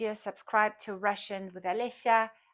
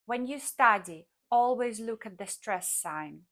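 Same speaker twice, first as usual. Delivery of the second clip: ordinary delivery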